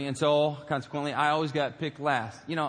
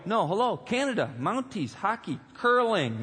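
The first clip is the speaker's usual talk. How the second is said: higher, emphatic listing, like it's obvious; mock exasperation; 5 semitones higher, slower than usual. New kid tells his friend why he's clueless, exasperated.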